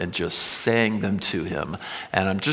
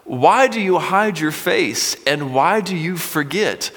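shouting at God; 6 semitones higher, 4 dB louder than usual. Acted. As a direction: cry out to God as if he were asleep. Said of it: "Now, he is shouting at God".

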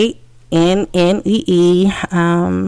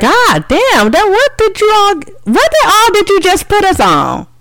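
high shocked outburst; 4 dB louder than usual. Real, not acted. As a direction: react to a shocking picture. Reacting to a picture on screen.

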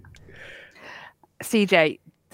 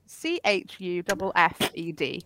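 ordinary delivery

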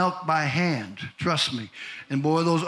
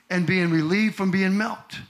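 ordinary talk

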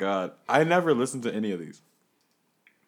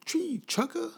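bouncer; 12 semitones higher than usual. Acted. Bouncer skit, high mocking voice.

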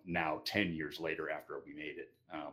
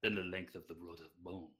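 put-on lightly held thick Russian accent; 3 semitones higher, 4 dB louder than usual. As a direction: keep the Russian accent going. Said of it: "in the most heavy Russian accent you can muster".

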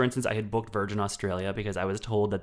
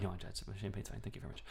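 whispered, much softer; hushed; 2 semitones lower, 16 dB quieter, much faster than usual. Retold or imitated. Ashamed passenger declining mimosa, hushed. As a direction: mutter it under your breath.

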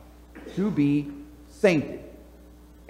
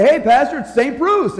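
higher, louder, cheery call to the pastor; cheerful greeting imitated; 7 semitones higher, 6 dB louder than usual. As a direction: hail the pastor proudly, a bit raised. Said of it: "he would say".

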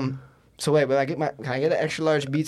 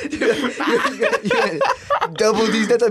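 words squeezed out through laughter; cracking up mid-line; giggling so hard the words barely come out; laughing; 11 semitones higher, 6 dB louder than usual. Real, not acted. Cracking up after prank.

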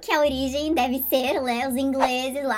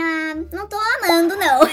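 1 hesitant; 4 semitones higher, 5 dB louder than usual. Retold or imitated. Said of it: "Aí eu falei assim".

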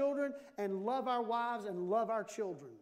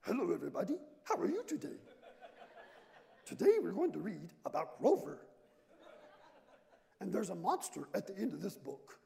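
put-on puppet voice with swooping pitch; comic read-aloud character voice; children's book voice; 3 semitones lower than usual. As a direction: do a silly storybook character for the kids. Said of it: "Daddy, do the voice".

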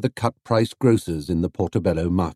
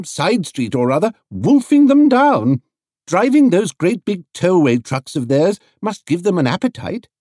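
higher, louder knowing lady, slowing on wolfing; wry lady; 10 semitones higher, 5 dB louder than usual. A narrator's reading. Lady tells Paddington the crew ate his buns, wry. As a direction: relish the gossip.

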